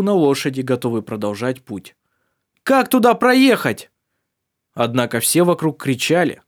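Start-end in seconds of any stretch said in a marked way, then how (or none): 2.7-3.9 high loud slow shout; shouting over wind. Acted. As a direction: call it out over the storm.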